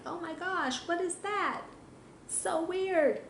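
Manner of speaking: a dog puzzled by a dry cleaning bag; spooked dog. Pretend. Trainer voicing a dog puzzled by a rustling bag, high and wary.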